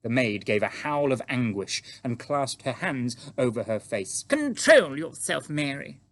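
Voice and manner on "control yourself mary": loud, high, drawn-out royal rebuke; sharply commanding, voice raised; sharp queen. Acted. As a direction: order the maid sharply to get a grip. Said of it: "the Queen said sharply".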